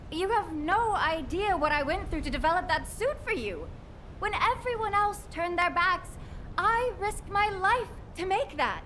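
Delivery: high, anguished accusation; hurt reproach. Acted. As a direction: protest with wounded indignation. Kate, hurt, reproaches Freyna.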